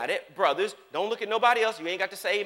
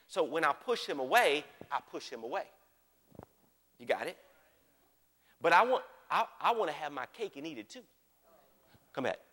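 usual speaking voice

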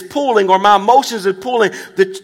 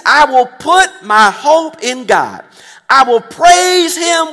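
preaching at full force; 3 semitones higher, slower than usual. Real, not acted. Pastor proclaiming to the congregation, slow and rising. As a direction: lean into every word, lifting the room.

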